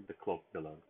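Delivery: ordinary delivery, nothing marked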